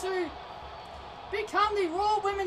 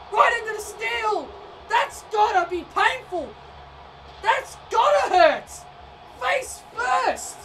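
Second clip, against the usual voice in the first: louder, higher, stunned play-by-play yell; excited shouting; 3 semitones higher, 7 dB louder, slower than usual. Real, not acted. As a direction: roar it like the crowd just exploded. Commentator yells at brutal spot.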